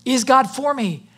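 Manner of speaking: ordinary delivery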